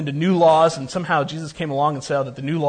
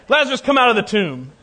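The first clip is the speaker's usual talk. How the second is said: raised, commanding call; loud voice; 5 semitones higher, 5 dB louder than usual. Acted. Jesus ordering Lazarus out of the tomb, loud but measured. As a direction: call out firmly, louder and a little higher. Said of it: "In a loud voice, he cried out".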